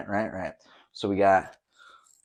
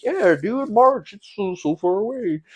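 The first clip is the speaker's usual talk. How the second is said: high, loud, breezy brush-off; mock-casual dude voice, pitched up; mock carefree past self; 10 semitones higher, 6 dB louder than usual. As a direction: wave it off like March is ages away.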